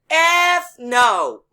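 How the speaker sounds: loud, high, emphatic refusal; loud retold refusal. Driver refusing the cop's request for a phone number, loud and defiant.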